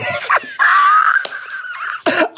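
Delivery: very high squealing laugh; piercing, helpless cackle; shrieking laughter. Real, not acted. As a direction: shriek with laughter till breathless. Still laughing hard.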